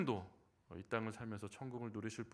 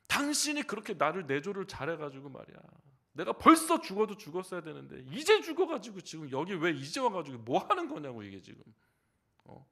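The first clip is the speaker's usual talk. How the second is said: louder, a touch higher scolding; mock angry husband; 5 semitones higher, 9 dB louder than usual. Elder scolding his wife in heaven for keeping him alive, mock anger. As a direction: nag the wife in hammed-up irritation. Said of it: "화를 내셨대".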